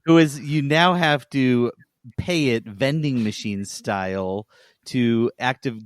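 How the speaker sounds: ordinary delivery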